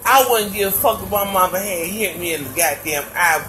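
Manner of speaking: ordinary talk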